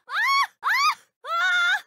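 very high loud scream. Acted. Game character shrieking in panic. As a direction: shriek at the top of the voice.

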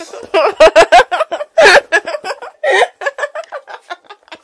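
long loud laughing fit; hearty laugh; full laugh. A narrator's reading. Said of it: "the chuckle became a laugh".

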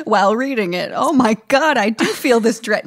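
laughing. Real, not acted. Laughing, excited reaction.

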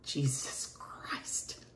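slow breathy whisper of dismay; whispered Jesus Christ to himself; whispered curse. Acted. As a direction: curse under the breath, slowly.

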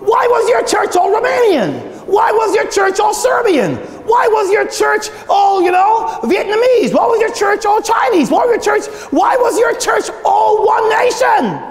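high, insistent preacher's challenge; high mock interrogation. God questioning pastors about their churches, high insistent demand. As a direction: call each pastor out, pitched high and driving.

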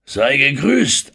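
langsam, laut und gehoben; grüße ganz langsam und laut. A narrator's reading. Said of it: "antwortete Engelhorn langsam". (gedehnte, laute Begrüßung)

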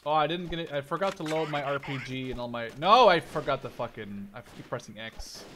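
ordinary talk

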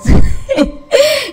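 high, loud giggle; nervous laughing burst. Real, not acted. Nervous laugh after hiding.

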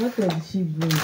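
usual speaking voice

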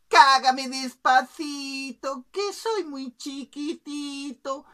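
high, slow singsong plea; pleading little bird. Acted. Bird's head pops out below the king, high slow plea. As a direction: plead long and high, starting loud. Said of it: "una cabeza que dijo".

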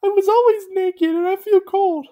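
very high startled cartoon voice; squeaky shock; Gumby's high voice. A narrator's reading. Gumby finds he is naked, very high voice. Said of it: "Gumby said".

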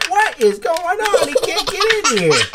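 high-pitched playful astonishment; mock bafflement. Toy dog finds vehicle blocked.

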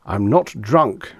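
slow, loud indignant protest. A narrator's reading. Accused of drunkenness, Reggie protests, slow and indignant. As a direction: deny it with wounded pride, slowly. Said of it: "Reggie said indignantly".

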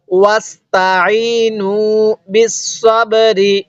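ওয়াস্তাইনু বিসসাবরি chanted slowly in a recitation voice. Shown as a demonstration. Teacher modelling the verse for students, slow chanted recitation. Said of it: "এরকম ভাবে শিখব".

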